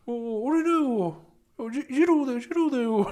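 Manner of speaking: slow stammering high voice; Jiro impression. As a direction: stutter the name slowly. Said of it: "二郎のモノマネ".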